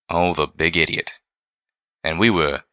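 ordinary delivery

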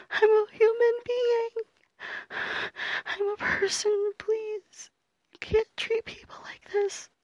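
high, shaky, desperate pleading; terrified horror victim. Acted. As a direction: beg as if your life depends on it. Captive begging for dignity.

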